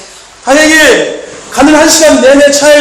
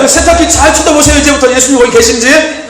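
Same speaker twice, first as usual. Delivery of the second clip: fiery preaching; 3 semitones higher than usual. Acted. Pastor urging the congregation to stay ready, fiery preaching. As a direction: preach it hard and wait for the amens.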